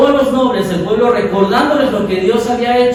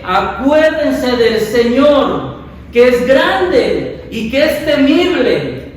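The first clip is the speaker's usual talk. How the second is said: very slow, each word weighed; slow solemn proclamation; 3 semitones higher, much slower than usual. Acted. Quoting Nehemiah to the people. (proclamación lenta y solemne)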